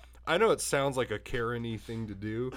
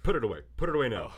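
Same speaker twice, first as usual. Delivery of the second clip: fast, louder, insistent; urgent command; 6 dB louder, much faster than usual. Retold or imitated. Mom hearing you kill cops, a fast louder command to the clerk. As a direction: rush the order out, half laughing. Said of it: "she was like".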